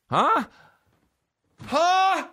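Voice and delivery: very high questioning huh; baffled huh. Acted. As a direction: squeak out an exaggerated "huh?". Acted listener who did not catch it.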